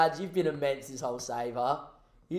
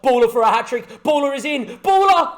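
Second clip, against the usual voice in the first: rising, louder goal scream; goal call; 7 semitones higher, 10 dB louder, faster than usual. Acted. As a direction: build to a big shout of the scorer's name. Commentating a hat-trick goal.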